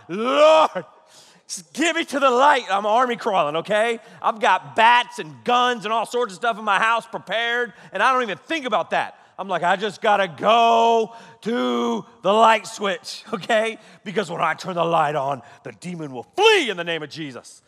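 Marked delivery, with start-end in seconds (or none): 0.1-2.6 high, loud plea to the Lord; hammed-up high-pitched pleading; desperate mock prayer. Retold desperate prayer while crawling. 9.6-13.2 slow, high, strained push through each word; drawn-out comic desperation, raised voice; desperate mock shout. Acting the desperate crawl.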